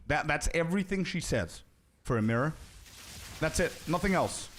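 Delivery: normal talking voice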